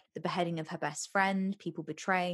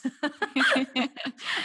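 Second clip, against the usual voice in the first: high bubbly giggle; giggling laugh; 7 semitones higher, 5 dB louder than usual. Real, not acted. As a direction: break into giggles. Laughing at own goosebumps.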